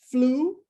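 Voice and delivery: "flu" said alone as a separate syllable; syllable before pause. Shown as a demonstration. Teacher splits influence for learners, "flu" set apart by pauses. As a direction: isolate "flu", then stop. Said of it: "tem outra pausa".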